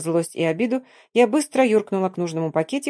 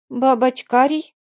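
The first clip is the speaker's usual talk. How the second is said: put-on surprised little boy; 5 semitones higher, slower than usual. A narrator's reading. Little boy reads box in wonder.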